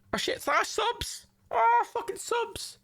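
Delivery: high, stretched-out cry of delight; high and slow, thrilled; excited yelp. Real, not acted. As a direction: squeal at the surprise subs. Surprised by new subs.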